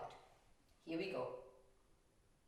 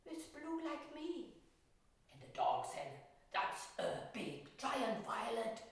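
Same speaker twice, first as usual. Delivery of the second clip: higher, gentle storytelling voice; story narration; 8 semitones higher than usual. A narrator's reading. Painter telling a children's story while painting. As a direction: tell it like a bedtime story for children.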